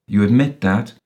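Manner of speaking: hard, grim challenge. A narrator's reading. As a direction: press him sternly. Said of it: "said Walter Stainsforth grimly".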